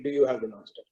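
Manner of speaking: a proud lion sneering the words back; scornful lion. Retold or imitated. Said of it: "And the lion says".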